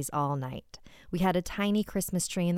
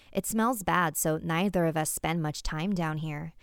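plain reading voice

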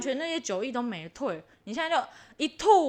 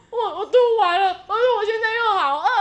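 higher, louder, cracking up mid-line; laughing through the quote; 8 semitones higher, 6 dB louder, faster than usual. Retold or imitated. Telling her friends what she said after vomiting, laughing hard. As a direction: giggle through it, barely holding together. Laughing while quoting herself.

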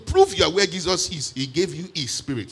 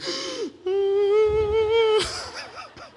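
long mock prophetic wail; high-pitched scream; 3 dB louder than usual. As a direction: imitate someone seized by a high, drawn-out scream. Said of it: "always with a high-pitched scream".

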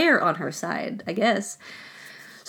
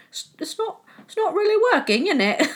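much higher, put-on sheepish officer voice, breaking into a laugh; mock British captain; 10 semitones higher than usual. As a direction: squeak it out like a flustered British officer admitting defeat. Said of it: "the British captains are probably like".

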